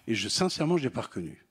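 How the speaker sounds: normal talking voice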